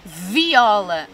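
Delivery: "Viola" with the opening v held and buzzing; stretched initial sound. Shown as a demonstration. Teacher showing children the first sound, "Viola" with held v. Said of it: "Começa pelo mesmo som".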